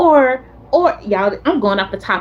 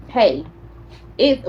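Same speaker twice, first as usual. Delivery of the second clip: ordinary delivery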